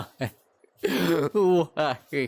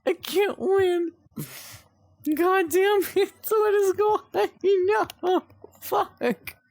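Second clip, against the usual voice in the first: frustrated wail; slower than usual. Real, not acted. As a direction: wail it, rising into a squeal. Game sends him back again.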